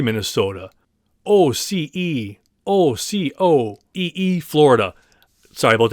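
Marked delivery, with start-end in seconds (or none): 1.2-4.4 slowly spelling out "O C E", one letter at a time, unsure; halting letter spelling. Real, not acted. Host stuck on a listener's town name, slow halting spelling. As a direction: spell "O C O E E" out slowly, stumbling over a name you can't read. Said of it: "pronounce that without completely butchering it".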